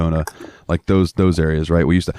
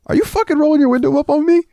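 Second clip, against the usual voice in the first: much higher, fast, louder outraged shout; outraged he's being ignored; mock furious yell; 3 dB louder, faster than usual. Wes yelling as the driver rolls his window up, high fast outrage. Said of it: "and Wes goes".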